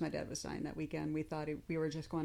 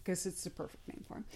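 fast whispered aside; whispering; 7 dB quieter, faster than usual. Real, not acted. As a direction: whisper it quickly.

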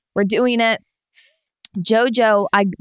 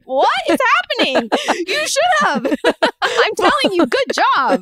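high, laughing and fast; laughing confusion; 14 semitones higher than usual. Retold or imitated. Reacting to a sobbing partner, high and laughing. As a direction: laugh through the words in disbelief.

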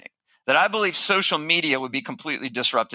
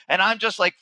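ordinary talk, nothing marked

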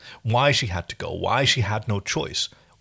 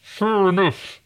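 choked, strained, high voice; like someone being strangled; 8 semitones higher, much slower than usual. Pretend. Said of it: "now it sounds like the person who is about to be murdered".

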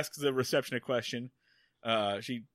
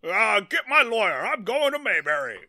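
higher, louder huffy rich man; blustering tycoon; 7 semitones higher, 4 dB louder than usual. Retold or imitated. Retelling the villain's outburst to his co-host, loud and huffy. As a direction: huff like a spoiled rich villain. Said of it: "Jay Howard is like".

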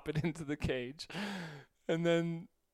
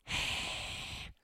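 breathy drawn-out snake hiss, no voice; imitated snake hiss; 4 dB quieter than usual. Snake owner imitating his boa hissing as he reaches up, scary hiss. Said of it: "like, scary hissing".

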